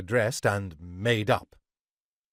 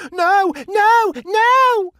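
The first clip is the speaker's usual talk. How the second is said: very high, loud woman's shriek; shrill fearful screaming; 9 dB louder, much slower than usual. A narrator's reading. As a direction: scream in terror, rising higher each time. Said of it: "went high and shrill with fear, screaming".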